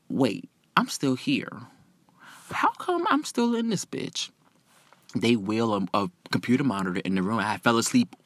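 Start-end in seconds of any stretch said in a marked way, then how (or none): 0.1-4.3 soft, groggy realization climbing higher; dawning confusion. Retold or imitated. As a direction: wake up puzzled, softly, then pitch it up. Waking up and realizing she's stuck.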